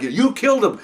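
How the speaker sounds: lightly voiced accusation, stress on "killed"; imitating an accusing look. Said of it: "giving that look like".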